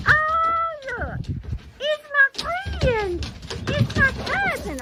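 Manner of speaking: high, soft squeal to the dog; excited pet talk. Real, not acted. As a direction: share the dog's joy, way up high. Dog sees cousin Darby arrive.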